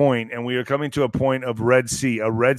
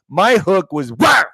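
sharp high "wah!" for a landing punch; a loud mock punch noise; punch sound effect; 4 semitones higher, 5 dB louder, slower than usual. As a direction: blurt out a loud whoosh of a hook. Mimics his punch landing.

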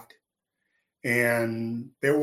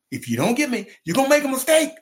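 high, loud, insistent warning; heated warning; 12 semitones higher, 5 dB louder, much faster than usual. Retold or imitated. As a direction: raise the voice to drive the warning home. Warning repeated, escalating, retold.

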